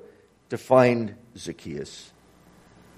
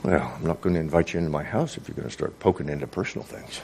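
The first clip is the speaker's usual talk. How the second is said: evasive grumble; 7 semitones lower, 5 dB quieter, faster than usual. Acted. Zacchaeus dodges, fast and low.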